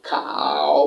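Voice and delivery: "খাও" drawn out very low, third tone; tone demonstration. Shown as a demonstration. Teacher demonstrates third tone, low drawn-out demonstration. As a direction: sink "খাও" deep and stretch it. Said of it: "তাহলে উচ্চারণ করব".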